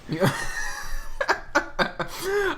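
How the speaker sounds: high, mostly voiceless laughter; wheezy amused laugh; real laughter. Real, not acted. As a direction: giggle high and airy at the joke. Amused by the Tumblr talk.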